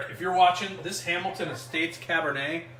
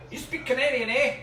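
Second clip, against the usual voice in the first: higher-pitched playful Canadian lilt; mock Canadian accent; 9 semitones higher than usual. Host asking viewers who speaks Canadian, mock Canadian accent. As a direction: put on a Canadian accent, tag on "eh". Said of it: "should we start speaking Canadian".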